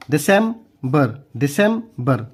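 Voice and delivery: "December" slow, disem... ber; broken into syllables. Shown as a demonstration. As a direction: split "December" before the final ber. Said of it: "जैसे मैं आपको तोड़ के बता रहा हूँ".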